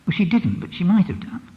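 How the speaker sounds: normal talking voice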